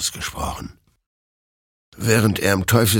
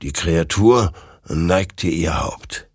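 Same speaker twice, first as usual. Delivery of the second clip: ordinary delivery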